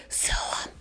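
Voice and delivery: unvoiced secret whisper. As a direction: lean in and breathe it. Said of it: "I whispered as she was going out that door".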